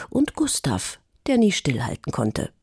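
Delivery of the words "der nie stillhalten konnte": plain reading voice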